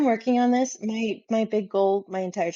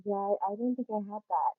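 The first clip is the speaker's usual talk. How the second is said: muffled mic, hand over microphone; 5 dB quieter than usual. Real, not acted. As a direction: sound blocked, like through cloth. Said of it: "It sounded, like, like, muffled".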